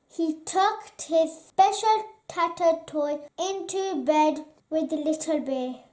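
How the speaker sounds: plain reading voice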